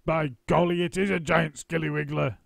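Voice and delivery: husband pretending to be frightened; mock alarm. A narrator's reading. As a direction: ham up the horror. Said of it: "Mister Twit said".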